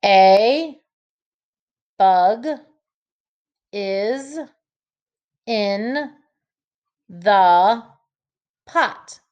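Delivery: long pauses between single words; word by word for sentence frames; one word at a time. Shown as a demonstration. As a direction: place each word with a pause. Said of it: "I'll show you".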